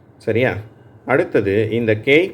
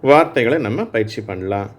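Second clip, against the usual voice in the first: ordinary talk, nothing marked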